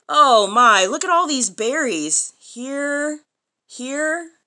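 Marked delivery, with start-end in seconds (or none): none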